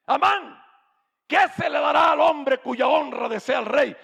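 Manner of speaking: loud, high royal summons; shouted question from the king; king calling out. Acted. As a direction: call Haman across the court. King calls Haman, shouting.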